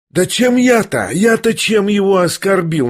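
high, mock-innocent protest; mocking insistence. A narrator's reading. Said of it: "всё как будто тем же насмешливым тоном". (настойчиво, насмешливо, высоко)

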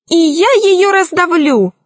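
high-pitched gloating threat; crying out. A narrator's reading. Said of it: "закричал весельчак У".

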